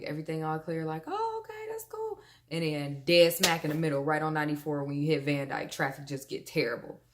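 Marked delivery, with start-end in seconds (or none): 1.1-2.2 high, light acted-out reaction; carefree mock reaction. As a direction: play it breezy, much higher pitched. Acts out carefree driver seeing clear roads.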